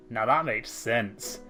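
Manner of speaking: ordinary delivery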